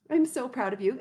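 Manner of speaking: ordinary talk, nothing marked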